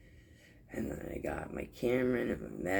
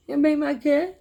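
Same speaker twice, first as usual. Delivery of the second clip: put-on high, louder whiny child plea; little niece; 9 dB louder than usual. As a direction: whine it like a frustrated toddler.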